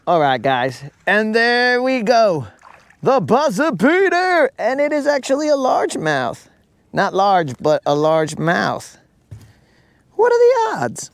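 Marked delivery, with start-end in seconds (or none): 1.1-6.5 higher, thrilled play-by-play of the catch; excited catch. Real, not acted. Landing a largemouth at the buzzer, excited high call. 10.1-11.1 much higher, squealing disbelief; excited squeal. Real, not acted. Landing the fish just in time, excited squeal.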